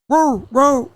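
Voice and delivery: high yappy dog barks; squeaky mock dog barking; imitating dogs. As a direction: bark like little dogs.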